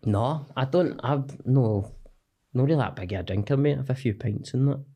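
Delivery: normal talking voice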